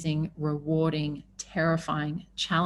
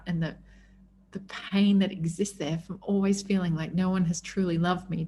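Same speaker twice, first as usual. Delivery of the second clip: ordinary delivery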